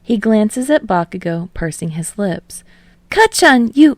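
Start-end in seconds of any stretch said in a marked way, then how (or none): 3.1-4.0 put-on high, louder boyish call; calls out to his rival; Midoriya voice. A narrator's reading.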